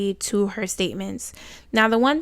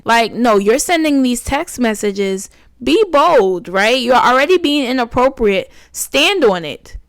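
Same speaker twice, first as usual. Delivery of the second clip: indignant scolding; 5 dB louder than usual. Real, not acted. Vlogger scolding the texting father, loud and indignant. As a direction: scold him to stand on it.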